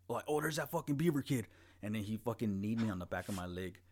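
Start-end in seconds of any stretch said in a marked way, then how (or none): 0.3-1.5 higher, louder taunting bully; mocking bully voice. Bully spotting him in the rain, higher louder taunt. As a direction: sneer it like the bully who found him. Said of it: "a ghost was like".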